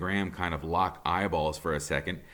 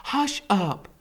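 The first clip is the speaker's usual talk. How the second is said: mother's voice; 13 semitones higher, 4 dB louder than usual. A narrator's reading. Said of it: "the Fair Gwen says".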